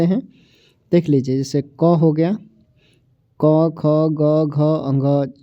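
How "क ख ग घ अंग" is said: क, ख, ग, घ, अंग spelled out very slowly, one by one; slow recitation. Shown as a demonstration. Teacher reciting consonants to the class, very slowly. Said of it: "ऐसे".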